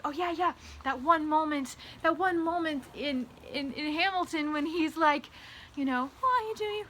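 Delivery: high, excited fangirl voice rattling on; gushing fangirl friend. Pretend. Voicing fellow fangirl gushing.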